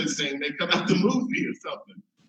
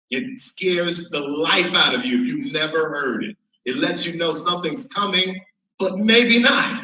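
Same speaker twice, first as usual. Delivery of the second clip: ordinary delivery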